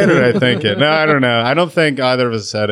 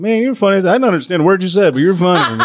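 imitated audience member; 7 semitones higher than usual. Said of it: "people come to me after a set".

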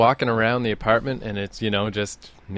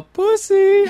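high, loud taunting jab; mock taunt, high and loud; 5 dB louder than usual. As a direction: sneer it like a dare. Taunting him in the park.

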